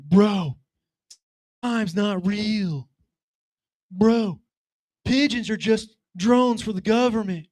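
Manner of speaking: slow, high, spaced-out stoner voice; stoned teen rambling in the hot tub. Retold or imitated. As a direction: drift through it like you're baked. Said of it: "clearly they're messed up".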